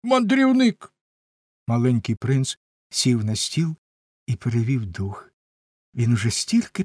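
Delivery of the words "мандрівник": high-pitched cry of discovery; excited exclamation. A narrator's reading. Old gentleman spots a visitor, excited exclamation. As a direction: call it out high, falling at the end. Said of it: "вигукнув він".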